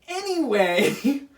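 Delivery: stretched, high sing-song anyway; drawn-out anyway. Real, not acted. Moving on from the topic with a slow, high anyway. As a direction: drag the word out to move on.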